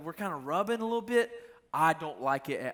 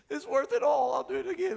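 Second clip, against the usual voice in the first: much higher, louder, giddy with joy; mock overjoyed; 10 semitones higher, 3 dB louder than usual. Son hearing dad's praise, gushing in a much higher voice. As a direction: gush like the praise made your life. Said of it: "You're like".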